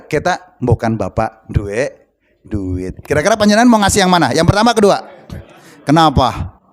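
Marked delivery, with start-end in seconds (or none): 0.0-3.0 put-on low, slow child's begging; quiet, dragged-out plea for money; pitiful pleading. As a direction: coax Dad meekly, fading softer at the end.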